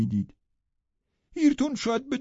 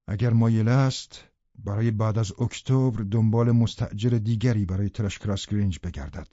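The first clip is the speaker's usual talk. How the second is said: lower, louder, different narrator voice; a reserved gentleman recounts his plans, lower; the tenant narrating; 8 semitones lower, 4 dB louder than usual. A narrator's reading. The tenant tells the reader he is leaving, in a lower, fuller voice. Second narrator takes over.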